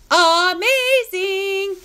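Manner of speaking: high, very slow "amazing"; drawn-out gushing. Real, not acted. Patient praising her surgeon Trey Fife, gushing. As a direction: stretch "amazing" out high, every syllable held.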